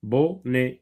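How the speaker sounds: "بوني" split bo... né; "bo", short pause, "né"; two syllables. Shown as a demonstration. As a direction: say "بوني" as French bonnet in two separate syllables. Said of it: "تتكون من مقطعين".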